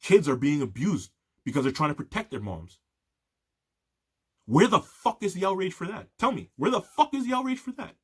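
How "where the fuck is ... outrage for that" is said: furious shout. Real, not acted. Ranting to viewers about ICE, building furious shout. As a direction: demand it louder and higher, building to a shout.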